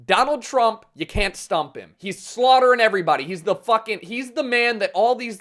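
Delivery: normal talking voice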